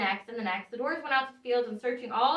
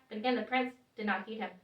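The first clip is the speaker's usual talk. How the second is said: usual speaking voice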